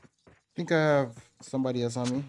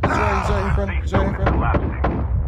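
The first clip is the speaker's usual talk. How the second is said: higher, louder urgent call; urgent callout; 5 semitones higher, 6 dB louder, slower than usual. Real, not acted. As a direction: shout the enemy location.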